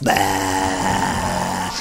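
low, drawn-out comic "aah"; imitated examination noise. A narrator's reading. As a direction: drop low and hold the bleat. Imitates noise while examining mouth.

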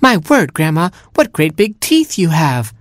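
high-pitched startled exclamation; astonished cry. A narrator's reading. Robin sees Grandma's big teeth, high astonished cry.

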